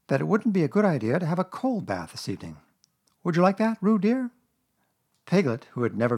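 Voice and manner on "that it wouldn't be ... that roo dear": raised, musing motherly voice; thoughtful Kanga. A narrator's reading. Kanga proposing a cold bath to Roo, thoughtful. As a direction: ponder it, then ask the child sweetly, pitched up. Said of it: "in a thoughtful voice".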